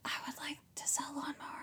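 mock ASMR sales whisper. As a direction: whisper it right into the mic. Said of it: "Some A S M R".

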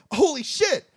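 high-pitched, hammed-up shock; mock astonishment. Host voicing everyone in Italy spotting Isabella, mock astonished. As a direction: imitate a stunned crowd, pitch jumping up. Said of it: "everyone in Italy thinks".

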